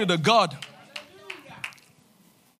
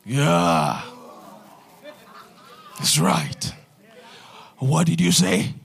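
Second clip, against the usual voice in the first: much lower, put-on deep commanding voice; 7 semitones lower, much slower than usual. Shown as a demonstration. Preacher showing the congregation how to walk in authority, deep voice. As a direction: drop into a big booming bass to show authority. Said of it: "with your deep voice".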